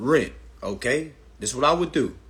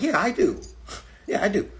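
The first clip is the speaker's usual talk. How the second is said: higher, cocky yes; smug salesman; 6 semitones higher than usual. Retold or imitated. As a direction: brush it off like a know-it-all salesman. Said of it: "They're gonna be like".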